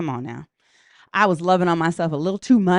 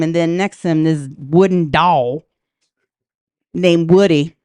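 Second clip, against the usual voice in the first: normal talking voice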